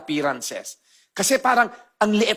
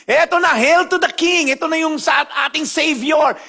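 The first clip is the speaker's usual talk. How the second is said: high, loud triumphant hail; crowd hailing the king; 8 semitones higher, 4 dB louder than usual. Retold or imitated. Pastor voices crowd hailing Jesus, loud triumphant cheer. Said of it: "they were all shouting".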